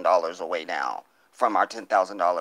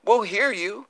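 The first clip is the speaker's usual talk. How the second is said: sing-song higher echo with a rising end; mocking echo; 11 semitones higher than usual. Real, not acted. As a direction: toss it back at them teasingly, pitched up. Repeats the line to taunt critics.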